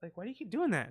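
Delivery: high mock woman's voice; irritated woman. Imitating an annoyed woman snapping at his hissing, high-voiced. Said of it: "And she's like".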